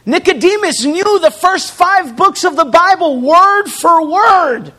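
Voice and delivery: loud, rising preacher's call; preaching at full force. Acted. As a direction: drive the point home to the congregation.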